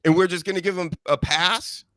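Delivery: high-pitched incredulous venting; exasperated rant. Real, not acted. Sports host venting about an overpraised punter, exasperated. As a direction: blow off steam in high, stretched questions. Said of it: "I gotta blow off some steam".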